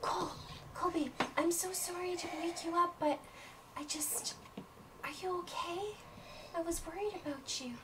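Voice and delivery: put-on high, soft anxious girl voice; high hushed worried voice; worried friend. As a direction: fret over your hurt friend. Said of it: "she says".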